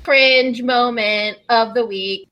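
slow, loud segment announcement; announcer voice. Pretend. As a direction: announce it like a show title.

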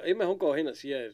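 ordinary delivery

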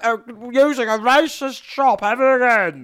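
high, loud hammed-up outrage; mock outraged shouting. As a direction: storm out of the shop shouting. Mock outraged customer storming off.

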